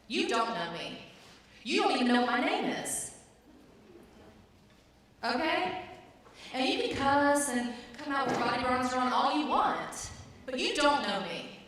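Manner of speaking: slow furious outburst at the trainer; angry outburst. Retold or imitated. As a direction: shout her down. She snaps back at trainer.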